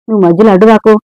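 ordinary delivery

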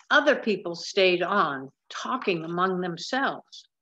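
usual speaking voice

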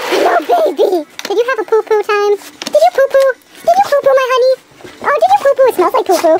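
high sing-song pet talk. Real, not acted. Talking to dog, baby talk.

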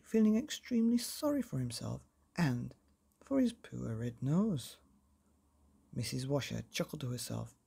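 plain reading voice

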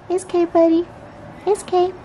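calm the scared cat; soothing the cat. Real, not acted. Soothing her cat Panther.

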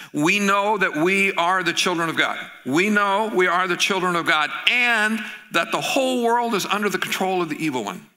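scripture reading. Real, not acted. Preacher reading First John to the congregation, weighty. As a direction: proclaim the verse.

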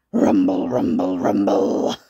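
low, loud, rolling rumble; deep rumbling sound effect; rumbling noise. Acted. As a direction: boom it out like a growling rumble. Imitating the stomach noise in story.

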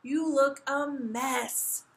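slow, high, loud dismay; drawn-out fatherly disgust; groaning. A narrator's reading. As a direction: groan each word in exasperation. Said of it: "he groaned".

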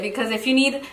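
usual speaking voice

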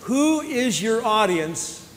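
slow emphatic question. Shown as a demonstration. Preacher asking athletes who they play for, slow. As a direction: draw out the question to make them think.

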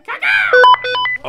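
long high loud crow caw; bird call. Acted. Bird man screeching a caw down the phone. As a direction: let out a full crow screech.

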